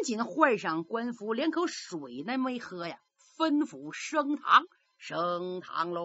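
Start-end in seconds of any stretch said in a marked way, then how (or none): 5.0-6.0 distant, slow, lower call to open court; court crier's call. Acted. Bailiff announcing the court is in session, drawn out and distant.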